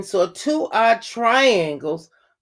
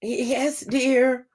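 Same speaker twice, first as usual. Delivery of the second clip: scratchy voice; 3 semitones higher, much slower than usual. A narrator's reading. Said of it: "came a scratchy voice".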